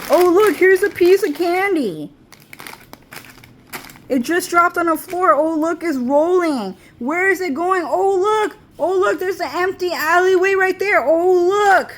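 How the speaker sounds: luring demon voice. Acted. Demon luring the viewer with rolling candy, high coaxing voice. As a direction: dangle the candy like a sly tempter.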